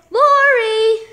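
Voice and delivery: loud, high call across the room; shouting for Lori. Acted. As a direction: call her name to reach her. Calling Lori from afar.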